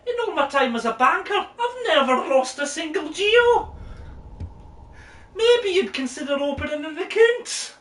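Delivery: high, giggly shopkeeper patter. Acted. Greeting the player as the game's shopkeeper, high and laughing. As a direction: play the fussy banker who can't keep a straight face. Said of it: "you were the shopkeeper".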